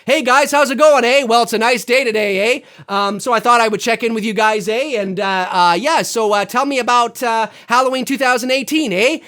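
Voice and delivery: lifted, exaggerated Canadian accent, leaning on each "eh"; mock Canadian accent. Checking in with you guys, mock Canadian accent. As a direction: greet viewers as a caricature Canadian accent. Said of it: "nobody says "a" fifty-five thousand times".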